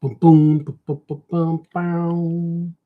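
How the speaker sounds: rapid popping "buh-buh-boom"; playful vocal drawing sounds; imitated booms. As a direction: patter out booms, stretching the last. Voices strokes while drawing.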